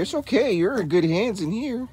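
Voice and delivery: high, gentle reassurance to a scared pup. Real, not acted. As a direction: comfort the puppy in a cooing voice. Soothing the scared puppy.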